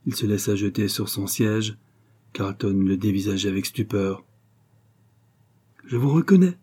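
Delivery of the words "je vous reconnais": high, louder cry of recognition; startled exclamation. A narrator's reading. Young man recognising the passer-by, startled high exclamation. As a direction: burst out as it suddenly clicks. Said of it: "s'écria le jeune homme".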